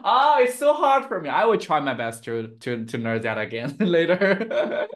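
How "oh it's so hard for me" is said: playful exasperation. Real, not acted. Laughing, giving up on pronunciation.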